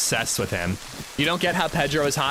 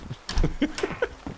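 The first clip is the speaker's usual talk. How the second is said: high breathless giggle; 14 semitones higher, 3 dB quieter than usual. Real, not acted. Reacting to teasing from chat.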